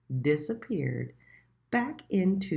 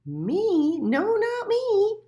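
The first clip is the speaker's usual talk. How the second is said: high, loud and slow denial; a lively hare brushing it off; 7 dB louder, much slower than usual. A narrator's reading. The hare denying it to Little Mole, high and slow.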